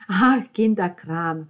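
loud, drawn-out brush-off; dismissive. Acted. Dismissing the trouble at the lunch table, loudly scornful. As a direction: wave it away with a long "Ah".

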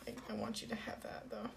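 soft whispered tease; whispering. Real, not acted. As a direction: whisper it teasingly.

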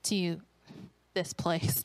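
low, dismissive whatever. Retold or imitated. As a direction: brush it off in a low voice. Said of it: "I just like".

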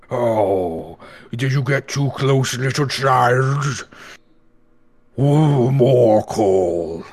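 put-on slow, sly creature teasing a child; taunting monster voice. Monster taunts then demands coal.

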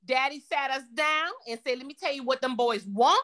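ordinary talk, nothing marked

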